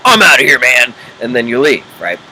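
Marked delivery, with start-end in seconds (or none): 0.1-0.9 1 brusque exit. Retold or imitated. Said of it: "you do things like".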